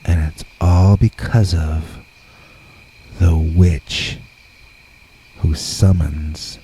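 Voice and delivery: usual speaking voice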